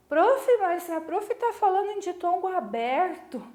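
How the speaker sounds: high-pitched pupil piping up; student's objection. Acted. Pupil querying the teacher about open diphthongs, high questioning voice.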